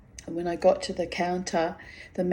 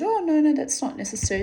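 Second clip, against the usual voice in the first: polite refusal; 9 semitones higher, faster than usual. Retold or imitated. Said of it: "I said".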